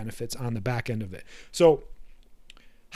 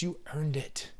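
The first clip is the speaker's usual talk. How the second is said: soft coaxing; 8 dB quieter, slower than usual. Retold or imitated. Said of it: "Your bitch voice is that voice inside that says".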